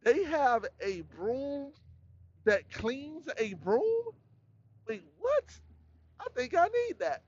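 high, slow mock amazement; high, drawn-out disbelief turning into wanting it; amazed discovery. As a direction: gape at a broom that cleans brooms. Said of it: "you'll be like".

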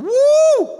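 high, loud whoop; a loud whoop of excitement; whooping shout. Real, not acted. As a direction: let out a big whoop. Preacher's exclamation.

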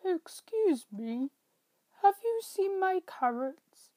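a gentle rabbit asking for help; polite rabbit. Acted. Rabbit asks the squirrels.

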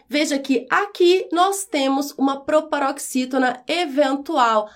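usual speaking voice

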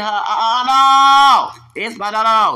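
loud, high outburst of no; angry shout. Real, not acted. Ranting to viewers about the gentrification feud, shouting. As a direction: bark the no's out in protest.

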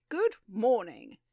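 loud, slow, drawn-out hail; big, expansive hello; hearty greeting. Acted. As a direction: boom a greeting into the room. Hearty greeting on opening door.

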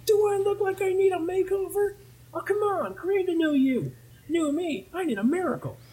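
very high falsetto protest; high-pitched mock indignation; indignant cartoon customer. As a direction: argue back in a squeaky-high put-on voice. Acting out customer rebuffing the salesman.

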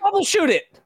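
very high mock child voice; squeaky-high mocking quote; mimicked film line. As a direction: chime it in high as a teasing imitation. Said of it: "She's just like".

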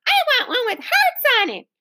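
put-on high child's wail. A narrator's reading. Girl with the wrong napkin complains, wailing. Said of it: "wailed a girl".

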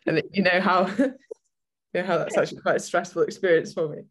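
talking while fighting a sneeze; holding back a sneeze. Real, not acted. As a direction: strain to hold in a sneeze. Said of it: "When you're trying not to sneeze".

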